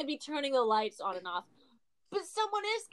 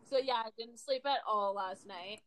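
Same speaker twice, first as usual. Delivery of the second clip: ordinary talk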